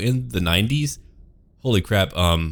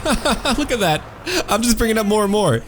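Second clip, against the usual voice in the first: high gleeful gloating; 13 semitones higher than usual. Real, not acted.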